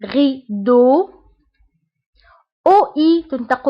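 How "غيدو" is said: غيدو drawn out slowly as French rideau; teacher slowly modelling غيدو as French rideau; slow French model. Shown as a demonstration. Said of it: "تنطق ب أو".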